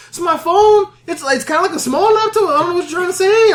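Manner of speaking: high-pitched, bewildered mock pleading; confused protest. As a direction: retell it as a squeaky, baffled defence. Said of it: "I'm confused".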